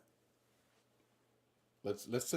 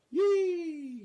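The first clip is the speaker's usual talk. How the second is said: high squeal; 9 dB louder than usual. Acted. Acted Maduro miming stand-up crowd.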